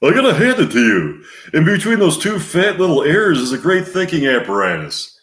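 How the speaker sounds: high, loud sitcom sidekick; comic needling in a high, swooping voice; Art Carney as Norton. Acted. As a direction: play a goofy, high-pitched buddy. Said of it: "Art Carney playing Norton".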